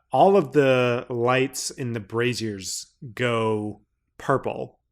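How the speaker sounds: normal talking voice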